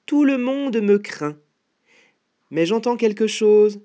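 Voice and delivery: high, confident mouse voice; boastful mouse. Acted. Mouse showing off to the gruffalo, high and boastful.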